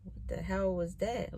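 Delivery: soft, stunned under-the-breath question; barely-voiced wonder, as if still in shock; hushed amazement. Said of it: "'cause I was just like".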